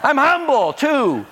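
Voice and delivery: high, loud, gushing eagerness; hammed-up shout to win favor; frantic mock flattery. Acts out sudden fawning at prophet.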